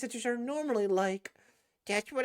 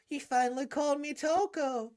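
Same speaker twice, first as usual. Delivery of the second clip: ordinary talk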